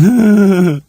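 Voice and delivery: loud, high jeering laugh at an enemy's feet; mocking caveman laugh. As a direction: point and guffaw like a taunting caveman. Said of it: "pointing at his feet and going".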